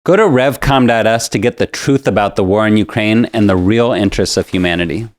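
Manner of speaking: usual speaking voice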